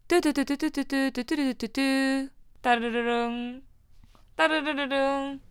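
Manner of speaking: slow mouth-made phone ringing; drawn-out ring ring sound; phone ring imitation.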